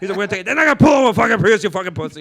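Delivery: building from talk into a loud, high, laughing rant; Joey Diaz riffing. Retold or imitated. Retelling Joey Diaz roasting an open mic crowd, loud laughing rant. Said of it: "That's a good impression".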